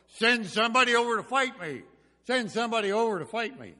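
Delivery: high, loud long-distance holler. Retold or imitated. Giant Goliath daring the Israelites to fight, hollered. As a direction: holler it across the valley. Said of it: "He could holler across".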